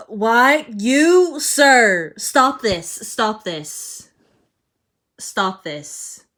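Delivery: loud, high exasperated scolding; indignant "stop this"; scolding outrage. Real, not acted. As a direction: tell off the character at full volume. Scolding a fictional character.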